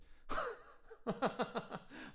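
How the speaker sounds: high breathy burst of laughter; amused laugh; real laugh. Real, not acted. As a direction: laugh at yourself. Laughs at own slip.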